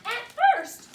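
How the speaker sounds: plain reading voice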